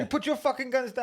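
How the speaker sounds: high-pitched mock standoff yell; imitated yelling. Friend mimicking six guys in a standoff, yelling. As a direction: yell it like panicked gunmen all at once. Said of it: "about six guys yelling".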